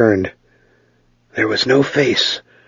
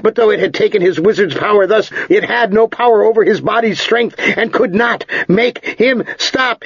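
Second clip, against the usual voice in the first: high, driven storytelling of a flight; urgent story narration; 8 semitones higher than usual. A narrator's reading. Narrator tells of Ged running into the night, urgent and tense. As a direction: push on, voice held high and tense.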